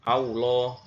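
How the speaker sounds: "Allah" said as "Aulo", with a W sound in place of the L; lam turned to waw. Shown as a demonstration. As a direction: show the mistake: "Allah" with a W in the middle. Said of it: "Jadi begini bacanya".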